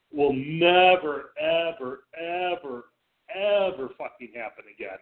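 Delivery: each never and ever drawn out slowly and hit hard; emphatic vow. Real, not acted. Refusing to give the GM his first-round picks, slow, heavy stress. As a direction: stretch out Will never, ever, ever, ever like a solemn oath. Vowing never to trade with him.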